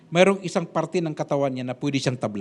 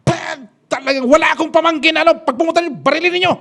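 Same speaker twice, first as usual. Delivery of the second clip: much higher, louder furious command; angry order; 11 semitones higher, 8 dB louder than usual. Retold or imitated. Padre Lahi angrily orders the guards, much higher and louder. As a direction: bark the order in a rage. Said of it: "Galit na galit si Padre Lahi".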